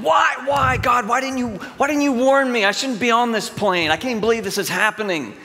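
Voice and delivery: high, loud pleading yell at God; hammed-up panic, crying out why; mock panicked shout. Acting out a frantic prayer on a falling plane, mock panicked yelling.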